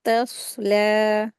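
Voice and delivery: तस्ला slow, with the स्वाद heavy and the ला drawn two beats; heavy saad. Shown as a demonstration. Teacher reciting तस्ला for students, heavy स्वाद. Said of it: "लाम को heavy नहीं करेंगे".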